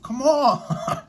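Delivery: sudden high, loud exasperated outburst; exasperated come on. Real, not acted. Vlogger scoffing at the interview clip, exasperated and loud. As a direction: throw it up in disbelief.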